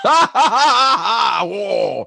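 loud laughing high whoa. Real, not acted. Laughing at his friend's nurse joke, loud surprised whoa.

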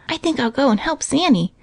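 ordinary delivery, nothing marked

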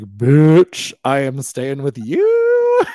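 loud, slow, emphatic retort; mock defiant shout at the doctor; defiant outburst. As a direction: belt it out, stretching each word.

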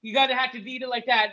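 high, careless sorry; careless bagger. Pretend. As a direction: toss off the apology way up high. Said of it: "the open it goes".

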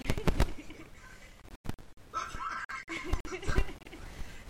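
a long, high burst of laughter. Real, not acted. Laughing at the grandmother's odd name, a long high laugh. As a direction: laugh out loud, high and breathless.

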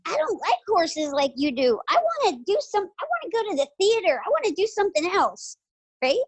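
put-on high-pitched young daughter, pleading; voicing her daughter. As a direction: lightly play the little girl who wants theater. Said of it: "And she said".

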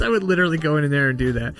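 ordinary delivery

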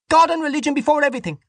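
very high, fast vehement woman; fervent cry. A narrator's reading. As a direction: cry it out like a zealot. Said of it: "Dante cried".

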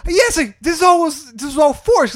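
shocked realization. Retold or imitated. Retelling characters realizing the trick.

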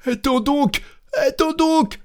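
very high, squealed plea from inside a chest; panicked cry. A narrator's reading. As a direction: yelp to be spared. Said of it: "s'écria le chantre dans le coffre". (cri aigu et paniqué)

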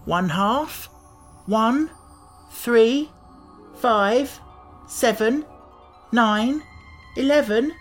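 high, measured count with pauses; counting aloud. A narrator's reading. Tip counts up to seventeen by twos, counting aloud. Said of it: "counted Tip".

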